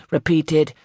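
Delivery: plain reading voice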